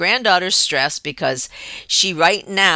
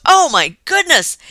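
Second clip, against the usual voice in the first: shocked exclamation; 11 semitones higher, 7 dB louder than usual. Retold or imitated. Husband sees tapeworms.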